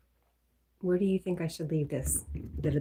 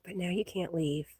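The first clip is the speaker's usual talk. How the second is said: usual speaking voice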